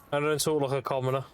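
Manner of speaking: normal talking voice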